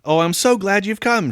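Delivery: put-on high, excited child voice; hammed-up childish relief; relieved little girl. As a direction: gush like a little girl greeting daddy. Girl reunited with daddy.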